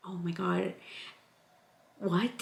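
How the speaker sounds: hushed, stunned disbelief; stunned confusion. Retold or imitated. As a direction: barely voice it, utterly confused. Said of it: "I was so confused".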